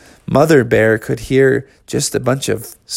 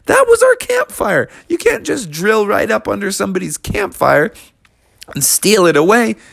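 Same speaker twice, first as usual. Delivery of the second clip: indignant bear; 8 semitones higher than usual. Acted. Bear protests the theft.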